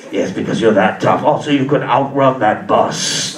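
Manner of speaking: very low, rasping Star Wars emperor; evil emperor voice. Retold or imitated. Inner voice on cocaine as the evil emperor, deep and menacing. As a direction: growl it like a sinister old emperor. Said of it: "that evil emperor guy".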